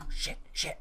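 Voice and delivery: urgent voiceless swearing; panicked whisper. Real, not acted. Player panicking at the alarm, frightened whisper.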